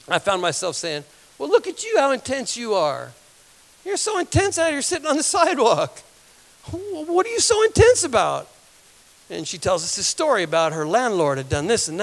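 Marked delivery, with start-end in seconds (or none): 1.4-8.5 high-pitched, teasing marvel; acted amused wonder. Man answers the ranting woman, acted amused wonder. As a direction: marvel at someone in a raised, swooping voice. Acts out his teasing reply.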